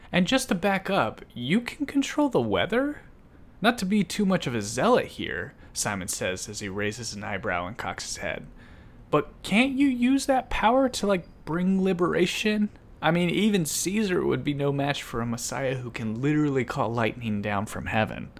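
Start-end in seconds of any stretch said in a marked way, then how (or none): 0.1-5.5 high-pitched, sceptical query; double-check something hard to believe; pitched-up comic disbelief; incredulous questioning. Acted. Simon sceptically questions Jesus's powers. 9.1-18.3 high, pleading suggestion; pitched-up comic insistence; incredulous pressing. Acted. Simon presses Jesus for liberation.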